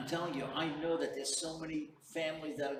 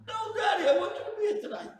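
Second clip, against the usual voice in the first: put-on high, shrieking child's plea; acted desperate pleading; 12 dB louder than usual. Boy begging father to stay tonight, acted wailing plea. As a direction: plead loudly like a frantic little kid.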